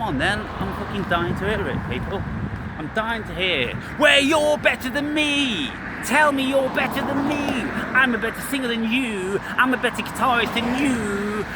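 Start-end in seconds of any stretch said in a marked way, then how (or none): 4.0-7.7 challenge. Real, not acted. Challenging viewers to prove themselves.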